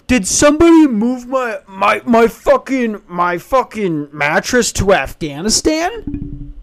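slow, drawn-out mock incredulity; exasperated disbelief. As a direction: stretch it out in baffled outrage. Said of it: "I'm just sitting there thinking".

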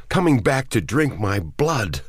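high, anguished outburst; trembling voice. A narrator's reading. Man tells of his wife returning, trembling. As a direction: cry it out in horror and grief. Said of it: "in a trembling voice".

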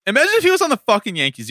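high fast outburst; indignant. Real, not acted. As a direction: fire it off, indignant and quick. Indignant rant about jersey.

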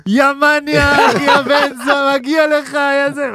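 high, slow jeering insults; hammed-up gloating rant; mocking taunt. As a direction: jeer at him in a high mocking voice. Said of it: "מתחיל ככה לצאת עליו".

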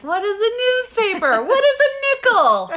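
high, loud baffled questioning; confused youngster. Retold or imitated. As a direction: act baffled by old things. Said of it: "people listening being like".

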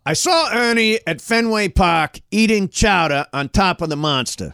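Boston accent with attitude. Shown as a demonstration. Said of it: "give it more attitude".

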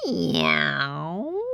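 long meow sliding upward; cat's meow. A narrator's reading. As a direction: meow slowly like a little cat. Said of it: "said the little cat".

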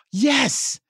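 very high, eager yes; excited girl. Retold or imitated. Young actress grasps the dog-bite direction, excited. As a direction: imitate a child thrilled to understand. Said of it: "she got so excited".

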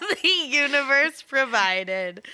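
laughing while echoing. Real, not acted. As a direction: giggle through the words. Laughing echo of friend's line.

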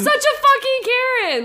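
high, quick exasperated jab; calling her out in exasperation. Real, not acted. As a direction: fling it out in disbelief. Exasperated at Karen.

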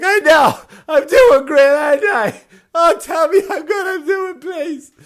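high, loud hammed-up weepy wail; exhausted runner begs the app for praise, hammed-up sobbing; mock tearful plea. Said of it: "literally tears in my eyes".

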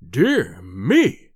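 low, loud mocking exclamation; sneering professor. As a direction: scoff it out in a deep voice. Professor mocks the threat.

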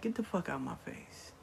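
low, quiet disgusted dismissal; soft, low-voiced disgust. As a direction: mutter it with retold contempt. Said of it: "he was so disgusted".

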